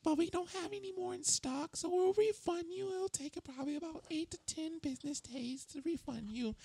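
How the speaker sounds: very high, whiny service-rep voice; squeaky, obnoxious phone-agent voice. Retold or imitated. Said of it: "the most obnoxious voice I could think of".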